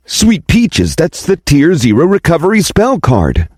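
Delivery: high, thrilled exclamation; excited. Acted. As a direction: gasp at a treasure. Awed sight of a rare card.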